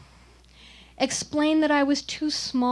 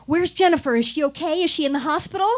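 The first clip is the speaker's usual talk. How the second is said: higher, louder, urgent panicked questions; frantic father; 5 semitones higher, 5 dB louder, faster than usual. Retold or imitated. Father bursting into the counselor's room, frantic. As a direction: burst in demanding news, alarmed. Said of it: "I heard a voice burst into".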